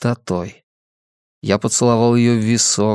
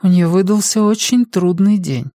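high-pitched voice of a nurse; a nurse explaining on the patient's behalf, pitched high; 8 semitones higher than usual. A narrator's reading. Nurse explains patient's tiredness to visitor, as nurse. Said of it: "подала голос медсестра".

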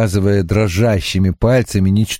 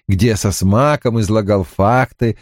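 ordinary talk

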